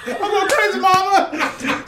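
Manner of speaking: very high, helpless cry to Mom; mock wail. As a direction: whimper it in exaggerated panic. Replays own wail to mom.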